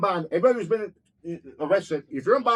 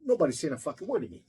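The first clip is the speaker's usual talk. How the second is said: ordinary talk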